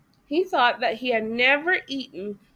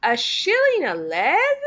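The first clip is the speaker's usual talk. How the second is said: louder, higher, outraged question; indignant cry; 4 semitones higher, 5 dB louder than usual. A narrator's reading. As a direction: cry it out in disbelief. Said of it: "cried Toad".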